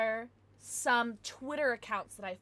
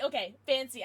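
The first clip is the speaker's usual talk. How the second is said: usual speaking voice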